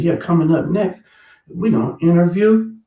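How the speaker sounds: lightly voiced TV promo; announcer voice. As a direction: tease the next segment like a host. Imitating the TV announcer.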